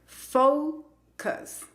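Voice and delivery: FOCUS split slowly, high "FOU", then "cus"; diptongo y schwa. Shown as a demonstration. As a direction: stretch "fou", pause, unstressed schwa in FOCUS. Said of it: "se pronuncia con el sonido". (FOCUS por sílabas: "FOU... cus", lento)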